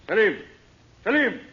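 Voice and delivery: loud, high call across the house. Acted. Master calls his servant from afar, loud. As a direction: summon the servant.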